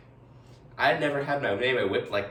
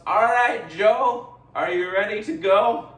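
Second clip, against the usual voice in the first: louder, higher call over a boat engine; shouting; 6 semitones higher, 6 dB louder than usual. Retold or imitated. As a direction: yell back from the boat. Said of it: "he looks back at me and shouts".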